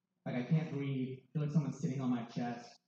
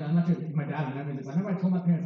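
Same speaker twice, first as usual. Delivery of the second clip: ordinary talk, nothing marked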